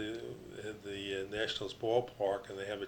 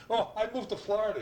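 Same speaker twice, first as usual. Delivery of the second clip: laughing quote; 12 semitones higher, 5 dB louder than usual. Retold or imitated. Charlie's retort, retold laughing.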